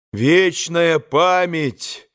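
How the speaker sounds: high, very slow solemn cry; ringing, drawn-out chorus of boys; boys joining in. A narrator's reading. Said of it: "подхватили снова мальчики".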